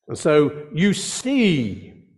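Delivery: "you see" drawn out slowly and weighted; slow, deliberate stress on "see"; emphasis on see. Shown as a demonstration. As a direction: lean on "see" and stretch it slowly. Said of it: "and I'm emphasizing that word".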